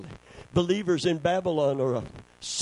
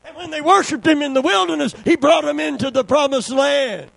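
raised, ringing sermon delivery; full-force preaching; 10 semitones higher, 8 dB louder than usual. Real, not acted. Preacher recounting the exodus to his church, full-force preaching. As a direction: preach it out to the congregation.

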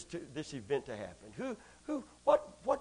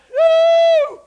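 high loud woo; ecstatic whoop; 18 dB louder than usual. Real, not acted. Preacher whooping with joy to the crowd. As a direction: let out a joyful whoop.

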